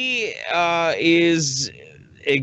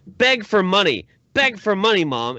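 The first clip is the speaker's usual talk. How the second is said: raised, louder mock pleading order; imitating pushy demand; 5 semitones higher, 3 dB louder than usual. Daughter making her mother solicit donations, mock demanding. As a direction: press the command like a pushy daughter. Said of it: "she's like".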